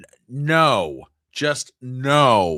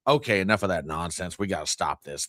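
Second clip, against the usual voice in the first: normal talking voice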